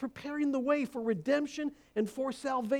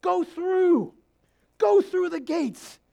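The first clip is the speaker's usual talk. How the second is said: loud, high call, drawn out; prophet's cry; 5 semitones higher, 8 dB louder, slower than usual. Acted. Preacher as Isaiah urging Jesus through, exultant shout. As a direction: cry it out as a rousing command. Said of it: "That's what Isaiah saw".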